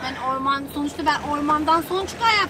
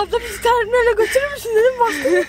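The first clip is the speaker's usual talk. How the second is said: laughing; 6 semitones higher, 7 dB louder than usual. Real, not acted. Laughing about being abandoned.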